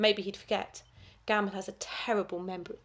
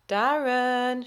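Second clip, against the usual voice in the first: bored call; 4 semitones higher, 6 dB louder than usual. A narrator's reading. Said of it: "she called in her bored voice".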